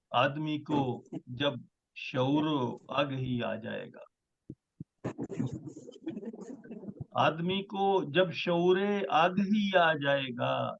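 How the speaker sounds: measured, stately poetry recital; ghazal recitation. Acted. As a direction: recite each line with weight. Poet recites new ghazal.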